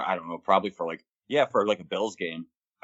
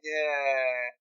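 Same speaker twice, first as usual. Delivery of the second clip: higher, drawn-out mock hesitation; noncommittal stalling; 5 semitones higher, 3 dB quieter than usual. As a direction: stretch it out like a reluctant dodge. Acted noncommittal reply to a request.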